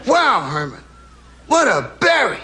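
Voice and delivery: acted awe, pitch leaping up on BERRY; amazed friend. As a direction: marvel at a prize as the friend.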